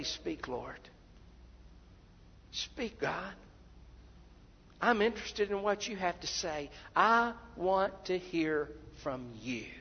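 hushed, earnest address to God; sincere prayer. Acted. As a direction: quietly open up to listen, slowing at the end. Models a sincere listening prayer.

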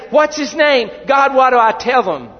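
high, loud shouted question; demanding shout. Retold or imitated. The Israelites challenging Moses, shouting high and loud. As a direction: shout it like a suspicious crowd. People demanding God's name.

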